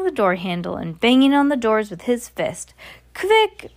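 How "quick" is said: high, loud witch shriek; piercing shriek of the Grand High Witch. Acted. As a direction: shriek it in sudden alarm. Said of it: "shrieked the Grand High Witch".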